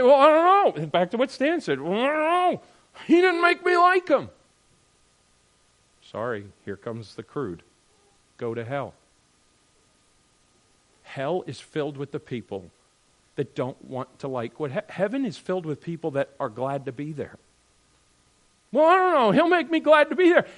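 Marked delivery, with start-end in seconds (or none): 0.0-0.9 high, loud mock protest; high-pitched mock "I don't know" whine; doubtful objector. Voicing a doubting, reluctant believer. 1.8-4.3 very high-pitched mock complaint; high, put-on protest; mock reluctant objector. Voicing a reluctant, complaining believer. 18.7-20.5 high, loud put-on excuse; very high mock protest; mock objector.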